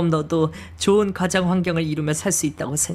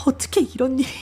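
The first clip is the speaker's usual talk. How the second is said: a touch of a pitched-up, breaking cry; breaking into sobs; 6 semitones higher, faster than usual. Acted. Mother to daughter about the past, starting to break down. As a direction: let the voice crack as tears start. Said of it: "피눈물을 흘리시며 오열하기 시작하셨어요".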